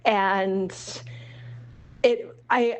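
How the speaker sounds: usual speaking voice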